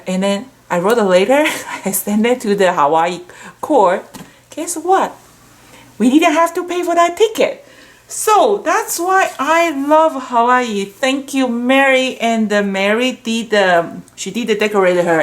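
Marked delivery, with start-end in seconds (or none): 4.6-10.9 excited. Real, not acted. Triumphant ending of Hawaii story.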